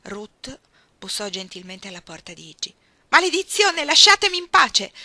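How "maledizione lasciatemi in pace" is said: loud, high-pitched angry shout; furious yell. Acted. Iggy to those outside her bedroom door, furious shout. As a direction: yell to be left alone. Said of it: "gridò Iggy dall'interno".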